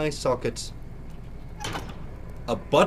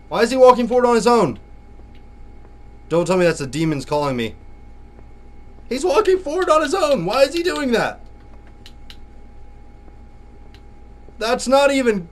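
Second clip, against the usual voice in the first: alarmed disbelief; 8 semitones higher, 4 dB louder than usual. Real, not acted. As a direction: pitch jumps high, louder, baffled at the glitch. Game glitch walks him forward.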